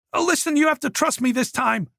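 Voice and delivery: put-on a cornered young man begging; Oliver pleading. A narrator's reading.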